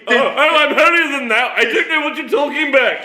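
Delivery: high, prissy mock innocence. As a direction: play the prim innocent, teasing. Mocking co-host's feigned innocence.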